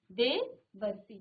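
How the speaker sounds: देवर्षि stretched out, syllable by syllable; slow dictation. Shown as a demonstration. Teacher dictating the answer slowly. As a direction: draw out देवर्षि so they can write it. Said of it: "चलिए इसे लिखेंगे".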